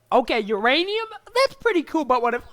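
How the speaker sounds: high, loud, escalating pitch; hammed-up eureka build; mock excited inventor. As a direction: get giddy with a bigger idea. Acted inventors getting carried away.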